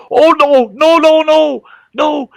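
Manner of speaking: very high, loud mock-horrified mother; dismayed mom. Mom seeing the dress turned teepee, dismayed. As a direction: cry out in an imitated mom's falsetto.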